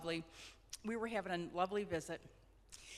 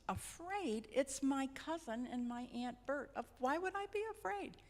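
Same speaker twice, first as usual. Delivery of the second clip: incredulous; 6 semitones higher than usual. Retold or imitated. Mother brushes off fear, incredulous.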